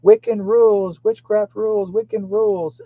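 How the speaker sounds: high-pitched chanting taunt, imitating her yell; mock yelling. Witch outside his house yelling her slogan, mock yelling. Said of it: "doing her yelling and screaming".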